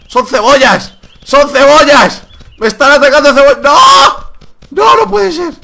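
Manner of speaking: high, loud panicked yells; panicked shouting. Real, not acted. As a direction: scream it in real panic. Attacked by onions in game.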